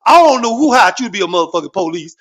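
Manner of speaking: usual speaking voice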